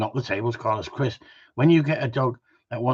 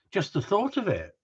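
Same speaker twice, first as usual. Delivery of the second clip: dreamy swoon; 9 semitones higher than usual. Shown as a demonstration. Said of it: "I'm blushing now".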